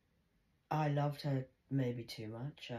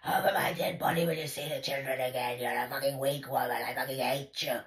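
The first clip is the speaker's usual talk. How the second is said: louder hissed threat as the daughter; imitating daughter's rage; 7 dB louder than usual. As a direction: snarl it as the furious daughter.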